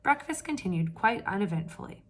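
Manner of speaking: ordinary delivery, nothing marked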